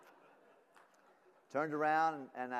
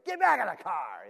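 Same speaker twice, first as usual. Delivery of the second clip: fed-up dad; 8 semitones higher, 15 dB louder than usual. Retold or imitated. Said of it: "part of me wanted to say".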